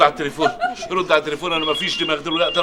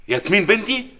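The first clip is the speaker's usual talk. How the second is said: ordinary delivery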